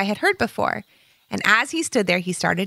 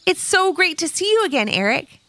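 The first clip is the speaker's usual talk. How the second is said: higher, delighted reunion greeting; happy exclaim; 10 semitones higher than usual. Acted. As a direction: burst out with joy at seeing him. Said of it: "exclaimed Levi".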